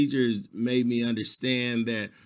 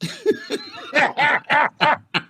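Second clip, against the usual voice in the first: high laugh; 12 semitones higher, 4 dB louder than usual. Real, not acted. Laughing at own joke.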